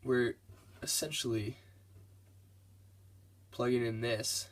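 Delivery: ordinary talk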